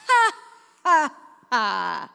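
short, loud mock-sinister ha ha; dry sinister laugh. Mother imitating her son's weird little laugh, mock sinister. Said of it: "He laughs at it and goes".

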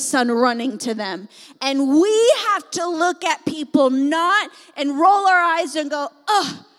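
rising fervent revival shout; full-force preaching. Acted. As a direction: preach it with everything, building to a roar.

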